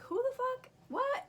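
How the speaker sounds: very high-pitched, incredulous questions; acted disbelief. Labouring patient reacting to rude nurse, high-pitched disbelief. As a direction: squeak it out in mock bewilderment.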